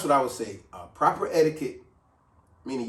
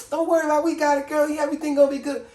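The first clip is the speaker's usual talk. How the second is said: high, loud mock reassurance; voiced friend; 11 semitones higher, 5 dB louder, faster than usual. Imitating the friend who swears the bill is covered, high and loud. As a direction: brush off the question, all confidence.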